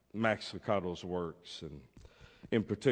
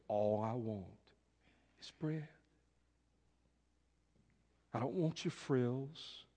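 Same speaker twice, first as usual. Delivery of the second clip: soft plea; 4 semitones higher, 7 dB quieter than usual. Acted. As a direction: plead quietly for the simple thing. World voiced pleading softly.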